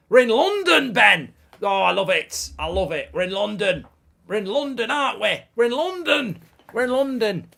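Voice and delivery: high, loud hammed-up chant; mock excited shout. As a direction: belt it out, high and insistent.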